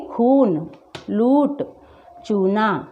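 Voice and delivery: long, weighted oo in "खून", "लूट", "चूना". Shown as a demonstration. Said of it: "हम इसका उच्चारण कैसे करेंगे?". ("खून, लूट, चूना" में बड़ा ऊ)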